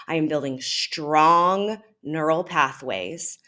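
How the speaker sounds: ordinary talk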